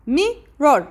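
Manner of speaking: mirror broken as mi, rror; split into syllables. Shown as a demonstration. Teacher sounding out mirror, syllable by syllable. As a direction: say mirror in two separate beats. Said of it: "Two syllable".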